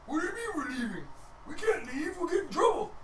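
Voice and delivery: high, rising panicked protest; a scared guard who fears punishment. Acted. Guard panicking at being told to leave.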